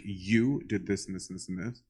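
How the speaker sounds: ordinary delivery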